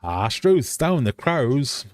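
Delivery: attempted Australian accent. Quiz host having another go, bad Australian accent. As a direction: draw out a badly faked Aussie exclamation. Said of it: "no, can't do it".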